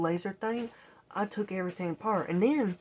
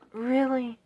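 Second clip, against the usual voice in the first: higher, louder, disbelieving; can't believe the wire too; exasperated raised really; incredulous really; 6 semitones higher, 4 dB louder than usual. Retold or imitated. Finding more corrosion.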